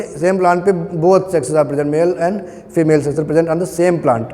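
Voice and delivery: slow, word by word, like dictating notes; dictation pace. Shown as a demonstration. Teacher dictating the definition for students to copy, slow. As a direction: spell it out slowly so they can write.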